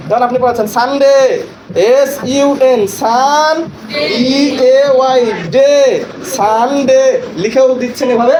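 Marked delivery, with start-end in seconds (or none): 0.8-7.0 সানডে spelled letter by letter, each syllable held; broken into syllables. Shown as a demonstration. Trainer models teaching সানডে to pupils, broken into syllables. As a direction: spell out সানডে, stretching সান and ডে apart. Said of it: "ভেঙে ভেঙে লিখে পড়াবেন".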